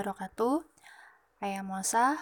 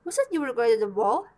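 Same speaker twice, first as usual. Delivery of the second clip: loud, fast scolding question; yelling stepmother; 10 dB louder, faster than usual. A narrator's reading. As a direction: fire it off as an angry stepmother. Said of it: "yelled the stepmother".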